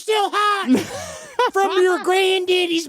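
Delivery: high, shrill yelling dad upstairs. Pretend. Mocking a vampire dad calling his son Scott upstairs, high hollering yell. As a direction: holler for Scott like a hillbilly father. Continuing mock dad call.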